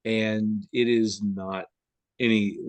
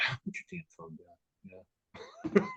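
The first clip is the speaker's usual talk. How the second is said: softer, slow, irritated mock grumble; annoyed grumble; 9 semitones higher, 9 dB quieter, slower than usual. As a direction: mutter it at the phone-starers, fed up. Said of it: "And I'm like".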